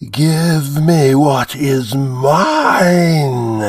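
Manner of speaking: high, very slow, wailing creature; demanding monster voice. A narrator's reading. Demon demanding its due from the priest. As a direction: drag out the demand like a beast.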